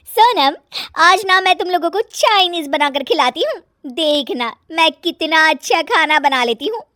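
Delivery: put-on high, loud show-off young wife; pleased-with-herself, high-pitched bossiness; nagging daughter-in-law, boastful.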